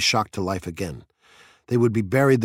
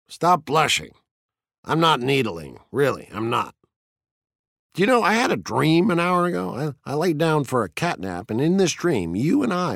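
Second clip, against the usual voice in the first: higher, lively teasing; taunting fire captain; 6 semitones higher than usual. A narrator's reading. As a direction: toy with him, pitched up. Narrator voices Beatty teasing.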